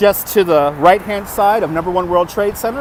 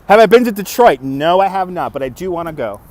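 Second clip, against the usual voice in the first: ordinary talk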